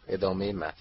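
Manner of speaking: plain reading voice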